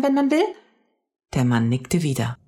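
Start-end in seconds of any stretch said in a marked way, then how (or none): 0.0-0.4 put-on very high child's voice, rising; astonished little girl; amazed question. A narrator's reading. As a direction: ask it sky-high with wonder. Mia asks again, man confirms.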